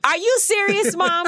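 high, loud incredulous cry; stunned son protesting to his mom; loud disbelief. Retold or imitated. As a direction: shout it in sheer disbelief.

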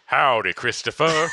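loud, hammed-up presidential greeting; Donald Trump. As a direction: boom it out as a bad role-play impression. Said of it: "I'm Donald Trump, right?".

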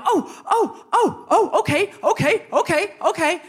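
high, startled mock yelps; squeaky, hurried placating; flustered backing down. Flustered elders giving in after outburst.

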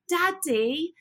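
put-on high-pitched toddler calling in a British accent; toddler's British accent. Retelling an American toddler's fake British accent, high-pitched mimicry. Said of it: "her daughter's like".

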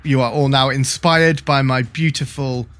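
ordinary delivery

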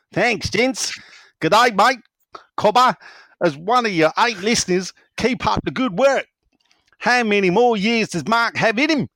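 mock Australian voice with rising ends; Aussie accent. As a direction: read it like a cheery Aussie bloke. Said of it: "Aussie accent".

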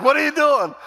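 high, loud startled protest; mock alarm. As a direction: imitate someone shouting out in alarm. Startled man being attacked, retold.

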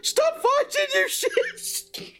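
very high strained yell; hammed-up squeaky-high shouted order. A narrator's reading. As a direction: yell to break up a fight. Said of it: "he yelled".

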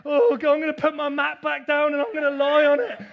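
mock woe-is-me moaning; hammed-up self-pity. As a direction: wail it like a hammed-up martyr. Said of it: "Oh, woe is me".

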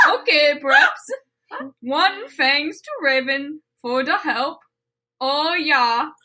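slow, lilting mock-Swedish; hammed-up Swedish accent, drawing the words out; Swedish Chef accent. Reading the author's note to laughing co-hosts, mock-Swedish accent. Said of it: "in the accent of Swedish Chef".

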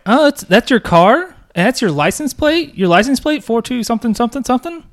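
high, prim suspicious voice; Angela. Retold or imitated. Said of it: "Angela's like".

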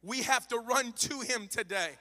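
normal talking voice

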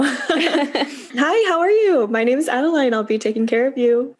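bright, high, polished greeting; chirpy help-desk welcome; customer service voice. Shown as a demonstration. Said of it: "What's your customer service voice?".

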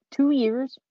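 high, loud rising question on "Two years"; incredulous. Real, not acted. Host doubting nobody deserved a nomination, incredulous. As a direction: throw "Two years" back in disbelief. Repeats it in disbelief.

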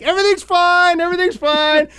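high nervous sing-song reassurance, imitating Shelly; anxious placating. Shelly soothing the tense elevator, high nervous placating. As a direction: chirp it like a kid calming fighting parents. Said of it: "Like a kid that's trying to get her parents not to fight".

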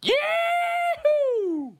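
a huge high whoop to open; hollering a greeting like a cowboy; big outback yahoo; whooping yell. Real, not acted. Caller opens message calling out.

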